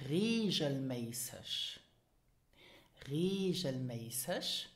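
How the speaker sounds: "Regelmäßig" slowly, as RAY-zhel-may-sesh; pronunciation example. Shown as a demonstration. Teacher giving examples to repeat, slow "Regelmäßig". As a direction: zh for the g, sh ending "Regelmäßig". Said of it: "repeat after me".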